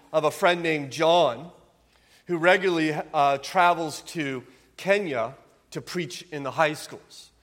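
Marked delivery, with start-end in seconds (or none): none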